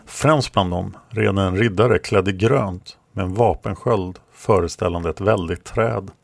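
ordinary delivery

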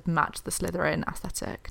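ordinary delivery

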